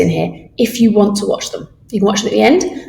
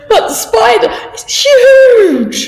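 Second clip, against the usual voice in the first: much higher, slow, loud alarm; scared; 6 dB louder, much slower than usual. Shown as a demonstration. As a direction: shriek at a big spider. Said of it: "you might be a little bit scared".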